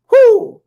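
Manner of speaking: high, loud joyful whoop; excited whoop. Real, not acted. Host whooping to viewers after a verse. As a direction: shout it out in triumph.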